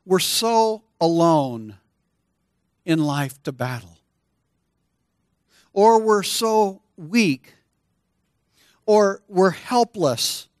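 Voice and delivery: slow, weighty build; slow emphatic preaching. Acted. Pastor naming life's struggles to the congregation, slow and weighty. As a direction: lean into each hardship.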